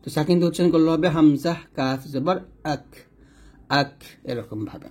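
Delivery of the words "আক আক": 'আক' with a light k, tongue a little forward; thin kaf ending. Shown as a demonstration. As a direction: end 'আক' thin and normal. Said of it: "নরমালভাবে পাতলা করে". ('আক' পাতলা করে উচ্চারণ)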